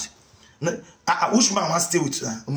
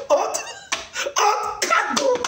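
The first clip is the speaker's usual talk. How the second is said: high, slow sneering jeer; mocking jeer; slower than usual. Jeering at the old women, slow high sneer. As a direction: drag it out to mock them.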